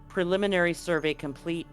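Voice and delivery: ordinary talk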